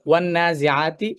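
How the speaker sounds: slow recited ওয়ান নাজিআতি with held nasal; teacher reciting ওয়ান নাজিআতি in full; Quranic recitation demo. Shown as a demonstration. Teacher showing students how to recite ওয়ান নাজিআতি, slow recitation. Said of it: "এখানে আমরা দেখি".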